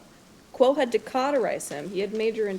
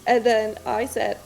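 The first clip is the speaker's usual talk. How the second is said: usual speaking voice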